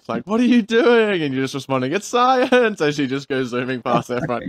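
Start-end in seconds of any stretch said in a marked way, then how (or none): 0.3-1.2 high, loud mock yell; imitated neighbour yelling. Neighbours yelling at the kid on the skateboard, mock shout. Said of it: "all the neighbors yelling out". 1.9-2.8 high, gleeful pretend shout; mock calling back. Kid zooming past answers the neighbours, playful mock shout.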